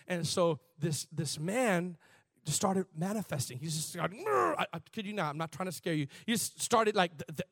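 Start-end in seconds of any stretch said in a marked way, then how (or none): 4.2-4.5 high mock demon snarl; imitated high-pitched growl. Preacher imitating a manifesting man, high growl. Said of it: "the demon was just kinda".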